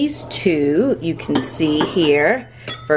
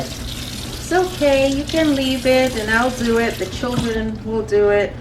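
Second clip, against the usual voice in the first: husband quoted; 6 semitones higher than usual. Retold or imitated. Retelling her husband's reassurance to viewers, lightly mimicked. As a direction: lightly mimic him, higher and soothing. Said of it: "even though he said".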